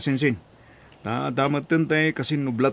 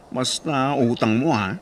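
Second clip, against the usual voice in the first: ordinary talk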